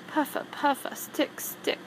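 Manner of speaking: whispered hedgehog puffs; soft breathy hedgehog sounds; hedgehog huffing. A narrator's reading. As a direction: puff it out like a little hedgehog. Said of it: "Hedgie went".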